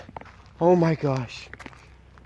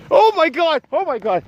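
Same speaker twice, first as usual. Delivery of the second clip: startled cry. Real, not acted. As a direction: caught off guard, shouting out in alarm. Fright after the noise.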